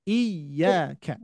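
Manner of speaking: slow "iyyaka" with the doubled y pressed; nabr on the y. Shown as a demonstration. Teacher contrasts the wrong and right reading, stressed y. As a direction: lean into the y of "iyyaka". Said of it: "jangan sampai iya".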